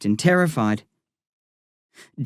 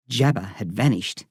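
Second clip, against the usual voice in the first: ordinary delivery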